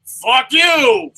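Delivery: high, loud mock shout; sudden high-pitched mock outburst; Ampa Man. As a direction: yell the refusal in a cartoon hero voice. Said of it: "And he's like".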